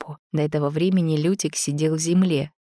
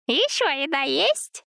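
piglet voice; 11 semitones higher than usual. A narrator's reading. Zina's piglet voice.

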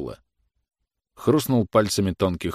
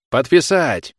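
loud, high one-word command; officer's peremptory demand to sign; barked order; 7 semitones higher, 7 dB louder, slower than usual. A narrator's reading. Officer throws down paper, orders signing.

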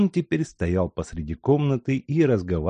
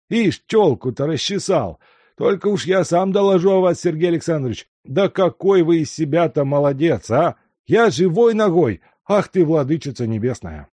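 put-on high, loud old man scolding himself; an old servant overjoyed and flustered; louder, higher doddering old man voice; fussing old servant; 7 semitones higher, 6 dB louder than usual. A narrator's reading.

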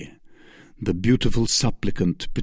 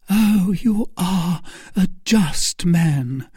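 fervent cry; 8 semitones higher, slower than usual. Acted. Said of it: "cried Milady".

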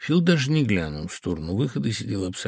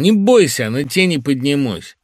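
loud, raised-pitch reassurance; barking; 4 semitones higher, 8 dB louder than usual. A narrator's reading. Gruff friend dismissing the host's worry, barking. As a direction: bark it out forcefully. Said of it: "рявкнул он".

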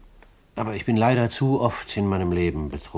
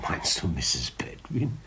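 whispered question; 4 semitones lower, 6 dB quieter, slower than usual. Acted. Gentleman answers boy's hush.

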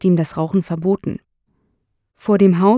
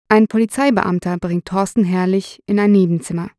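usual speaking voice

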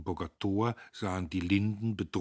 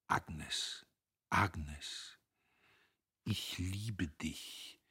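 softer and very slow, pressed out from need; in deep distress; 6 dB quieter, much slower than usual. A narrator's reading. Dietrich confesses love to Agnes, softly anguished. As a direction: breathe it out as if in anguish. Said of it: "sagte er wie aus tiefer Not".

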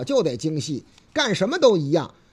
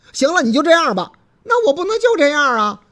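急着争吵; 6 semitones higher, 7 dB louder than usual. Acted. 司机与姑娘争吵.

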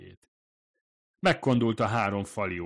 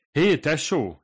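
raised voice, pitched up, calling to the other room; a light call across the café; calling out from the kitchen; 8 semitones higher, 9 dB louder, slower than usual. A narrator's reading. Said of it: "kiszólt a konyhából".

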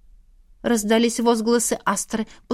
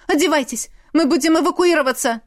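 high, hurried, anxious order; voice breaking; 6 semitones higher, faster than usual. A narrator's reading. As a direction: rush it out in rising alarm. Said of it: "срывающимся голосом проговорила мама". (торопливо, срывающимся голосом)

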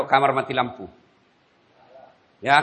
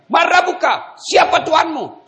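booming angel; 7 dB louder, slower than usual. Acted. Angel interrogating the dead.

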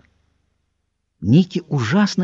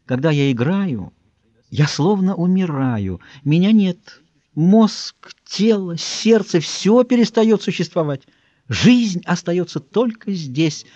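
lightly voiced preening pianist, high and drawn out; a hint of a showy, self-admiring artist; affected mincing voice; 4 semitones higher, slower than usual. A narrator's reading. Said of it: "звучным, но ... ужасно жеманным голосом". (слегка жеманный, манерный голос)

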